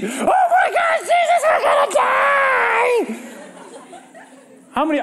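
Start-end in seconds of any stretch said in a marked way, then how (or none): 0.1-3.2 high frantic cry of terror; panicked scream. Acted. Disciples screaming to sleeping Jesus in storm, panicked high cry. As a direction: scream it like the boat is sinking.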